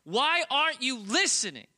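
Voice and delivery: put-on high, loud hurt child voice; a small son wailing that daddy won't listen; upset little boy. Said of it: "he always says the same thing".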